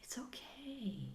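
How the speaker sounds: put-on soft, comforting whisper to a child; tender whisper. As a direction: whisper reassurance to your scared inner child. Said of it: "you say".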